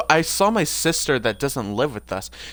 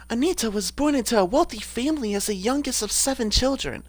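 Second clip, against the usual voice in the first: raised-pitch character voice; Anita; 5 semitones higher than usual. Acted. Streamer reading a character's bio aloud, higher put-on voice. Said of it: "what was Anita's voice?".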